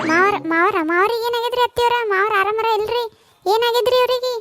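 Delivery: put-on high-pitched, anxious young in-law voice; worried daughter-in-law. Daughter-in-law asks weeping mother-in-law what happened, worried. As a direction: ask in a high, concerned voice.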